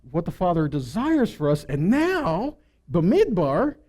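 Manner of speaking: ordinary delivery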